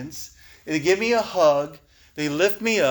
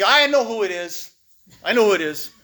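loud, raised voice of a tough guy; Tomas impression; 5 semitones higher, 5 dB louder than usual. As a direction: cut in loudly, imitating a blunt sponsor. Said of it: "That's how he talks".

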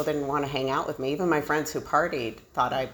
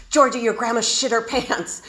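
higher, louder matter-of-fact mom; 8 semitones higher, 4 dB louder than usual. Retold or imitated. Mom telling her daughter it's normal, matter-of-fact. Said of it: "as if I should know it".

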